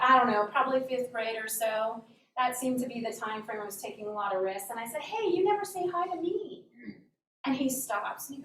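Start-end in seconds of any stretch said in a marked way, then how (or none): 5.0-6.6 put-on higher-pitched, challenging kid; bold child calling out. Child challenges the principal at recess, bold and higher. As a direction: pipe up boldly as a kid.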